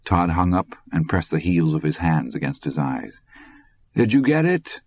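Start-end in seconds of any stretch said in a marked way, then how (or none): 4.0-4.8 put-on high, feeble call from an old man; weak call. A narrator's reading. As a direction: call out weakly, pitched high. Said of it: "Dusander called weakly".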